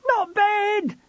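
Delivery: high, loud panicked cry; shout. A narrator's reading. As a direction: shout it out in alarm. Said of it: "George shouted".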